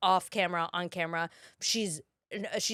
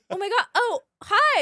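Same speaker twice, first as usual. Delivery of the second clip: high, loud starstruck hi; starstruck greeting; 11 semitones higher, 6 dB louder than usual. Retold or imitated. Bumping into Jennifer Coolidge at the truck, starstruck greeting. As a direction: gush at meeting a celebrity. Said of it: "I'd be like".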